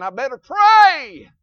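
slow, loud drawn-out declaration; loud resolve. Real, not acted. Preacher resolving to pray, loud and slow. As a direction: stretch it out with force.

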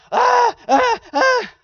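high wailing baby cry, mimicked; imitated baby cry. Preacher mimics a crying baby to his congregation, high wail. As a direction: wail like a newborn wanting attention. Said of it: "little babies and they're just crying".

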